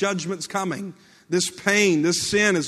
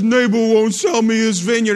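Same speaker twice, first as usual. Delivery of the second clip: whiny sulking king; 8 dB louder than usual. Retold or imitated. Sulking king Ahab voiced.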